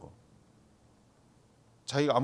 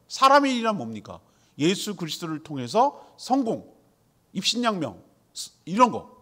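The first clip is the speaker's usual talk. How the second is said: loud, driving sermon listing with rising force; preaching at full force; 4 semitones higher, 5 dB louder than usual. Acted. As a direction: hammer each point home to the congregation. (힘있게 외치는 설교)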